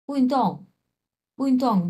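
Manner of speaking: উইনটং said high with sharp falling fourth tones. Shown as a demonstration. Teacher modelling the falling fourth tone. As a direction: drop each syllable of উইনটং hard from high. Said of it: "দুইটাই চার number টন".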